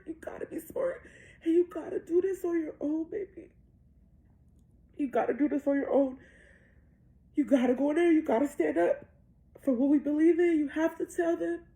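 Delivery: loud, high tearful urging; real crying. Real, not acted. Mother coaches daughter to stand up for belief, crying. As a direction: push the words out forcefully through tears. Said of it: "I'm crying".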